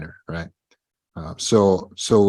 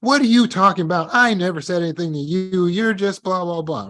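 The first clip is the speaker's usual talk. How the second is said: higher, mock-dismissive gaslighter; higher, mock-innocent denial; gaslighting quote; 8 semitones higher than usual. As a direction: brush him off, pretend innocence. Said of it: "gaslight the men and say".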